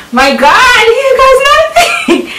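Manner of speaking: high squealing laugh, stretched out; half laughing, half shrieking with nerves; real overwhelmed laughter. Real, not acted. Overwhelmed opening her article.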